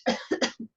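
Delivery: a thin, breathy cough; weak cough. Acted. Barbie coming to by the fire, coughing weakly. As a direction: cough weakly as she comes round. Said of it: "Barbie starts to cough".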